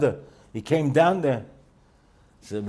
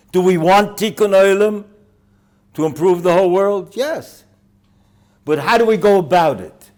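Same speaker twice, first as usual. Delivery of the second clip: louder, higher preaching voice; forceful preaching; 9 semitones higher, 10 dB louder than usual. Real, not acted. The rabbi challenges his congregation on Tikkun Olam, forceful preaching. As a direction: build it up to the congregation.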